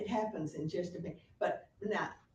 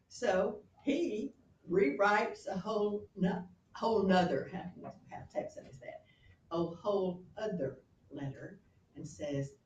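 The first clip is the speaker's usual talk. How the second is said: ordinary talk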